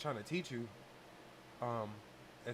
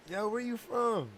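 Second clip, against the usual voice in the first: raised, high-pitched holler; calling out; 8 semitones higher, 6 dB louder, slower than usual. Retold or imitated. Man hollering to a girl at the drive-through, calling out. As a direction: holler it over to get someone's attention.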